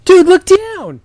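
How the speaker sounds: high loud shout; alarmed shout. Acted. Chris warning Hunter about the robe, high loud shout. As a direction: yell it in alarm. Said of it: "Hunter looked down".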